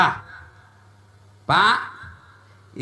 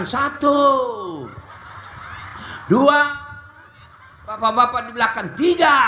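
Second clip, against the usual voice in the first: mock counting; 2 semitones higher than usual. Imitates aerobics instructor counting, laughing.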